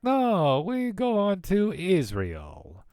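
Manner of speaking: put-on high, slow Russian-accented dad; put-on Russian accent, pitched high and drawn out; dad's Russian accent. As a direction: imitate dad's Russian accent, stretching the words. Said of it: "So my dad's like".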